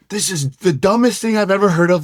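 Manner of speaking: high-pitched, exasperated retold outburst; retold yell. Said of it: "I'm yelling. I'm like".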